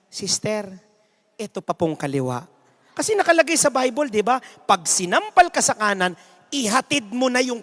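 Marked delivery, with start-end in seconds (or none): 0.1-2.5 softer, lower meek voice; meek offering. Acted. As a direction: offer the other cheek humbly. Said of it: "sasabihin niya".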